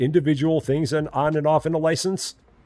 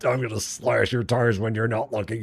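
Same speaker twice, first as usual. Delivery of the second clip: grumbling Fred. Pretend. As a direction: mutter like the fed-up clerk. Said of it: "He's just like".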